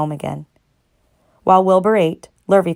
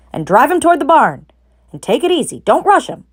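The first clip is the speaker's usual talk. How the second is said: farmer giving orders; 8 semitones higher, 5 dB louder than usual. A narrator's reading. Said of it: "said Mister Zuckerman".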